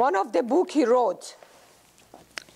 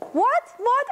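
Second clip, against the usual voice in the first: startled; 12 semitones higher than usual. Acted. Grandma startled.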